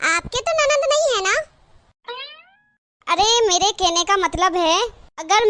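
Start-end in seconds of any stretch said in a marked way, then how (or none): 0.1-1.4 put-on higher innocent kid voice; a child pointing out the obvious; child's voice. Child interrupts aunt. (भतीजी चाची से मासूमियत से पूछती है)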